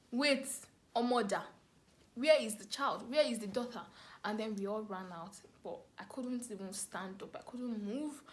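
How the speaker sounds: ordinary talk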